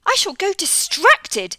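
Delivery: high, loud, slow scolding. Acted. Missus Tittlemouse scolding amid the mess in her house. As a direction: scold in despair. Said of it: "scolded Missus Tittlemouse".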